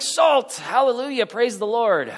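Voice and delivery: high-pitched joyful burst over salt; mock Dutch delight. Preacher teasing Dutch congregants about their salt, mock rapture. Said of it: "most Dutch people here would be like".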